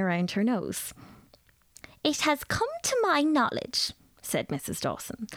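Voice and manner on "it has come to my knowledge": a grand lady holding forth, pitched a little higher; lecturing lady. A narrator's reading. Mrs Dawson lectures the nervous girl about new tea, grandly. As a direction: pronounce on tea like an authority.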